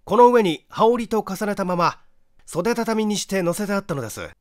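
detective showing the prosecutor the spot; detective's explanation. A narrator's reading. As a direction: point and explain firmly.

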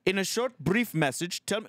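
put-on high-pitched Indian-accented automated prompt; robot phone agent. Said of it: "And you go".

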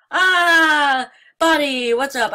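loud, high startled cry; scared yelp. Real, not acted. Frightened player yelping as Bonnie appears. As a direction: jump at the sight and yell.

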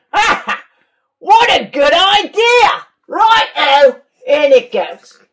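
high, loud hearty laugh and shout; laughing Dingo. Acted. Dingo laughs at the mud idea, loud and high. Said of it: "Dingo laughed".

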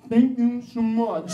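slow, overly grateful thank you; boy thanking the generous cashier, slow and earnest; sincere thanks. Retold or imitated. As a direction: lay the gratitude on, slowly. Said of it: "I looked back at him and I was like".

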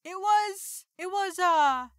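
high, halting put-on voice; high-pitched stalling. Disguised initiate dodging a question about the proposal, high and halting.

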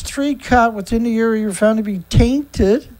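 ordinary talk

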